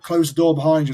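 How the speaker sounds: loud, high retold command; retold order. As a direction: bark the order over your shoulder. Senior barking orders.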